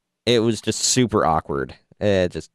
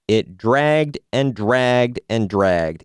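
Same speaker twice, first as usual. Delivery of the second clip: slow and drawn out; much slower than usual. Real, not acted. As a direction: stretch each word very slowly. Drawn-out to mimic dragging.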